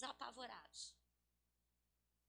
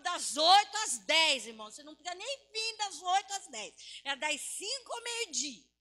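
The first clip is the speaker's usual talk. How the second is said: loud, high, urgent exhortation; impassioned preacher at full voice; full-force preaching; 6 semitones higher, 13 dB louder than usual. Real, not acted. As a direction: drive the challenge home to the congregation.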